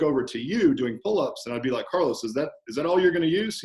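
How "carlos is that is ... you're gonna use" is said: raised, teasing coach's challenge; goading gym banter, pitched up; friendly challenge. Acted. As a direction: needle a regular client good-naturedly. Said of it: "challenging someone who's more advanced in a friendly manner".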